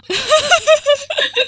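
shrieking laugh; high burst of laughter. Real, not acted. Friend asks if genres get confused.

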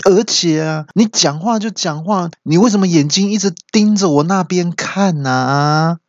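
high, rattled complaint; acted indignation, stretched out at the end; startled protest. Startled friend protesting to the intruding uncle, flustered. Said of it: "就受到惊吓".

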